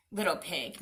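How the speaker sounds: ordinary delivery, nothing marked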